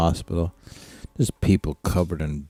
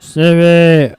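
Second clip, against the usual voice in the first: loud, high yelp of a name; sharp call to dog; 11 semitones higher, 11 dB louder than usual. Real, not acted. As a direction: snap the dog's name out loud. Scolding the dog.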